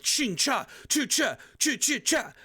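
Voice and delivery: high, rapid-fire attack noises; imitated attack sounds. Streamer imitating big attacks going off, mock impact noises. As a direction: mimic big game hits with your mouth. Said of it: "these big attacks are going off".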